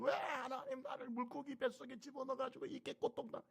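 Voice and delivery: high whiny grumble at God; high, whining mock lament to God; mock complaining believer. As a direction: whine like a faithless complainer. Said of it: "믿음이 없는 사람은 하나님을 원망합니다".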